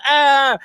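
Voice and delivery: short, very high fake scream of fright; high mock scream. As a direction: let out a hammed-up high-pitched scream. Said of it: "That's what he sounded like".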